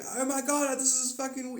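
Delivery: high-pitched mock freak-out; losing your mind. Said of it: "losing your mind".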